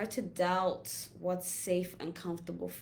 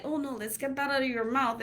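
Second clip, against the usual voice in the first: puppy scolding; 7 semitones higher, 4 dB louder, faster than usual. Retold or imitated. Scolding new puppy playfully.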